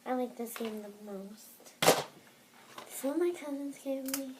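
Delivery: usual speaking voice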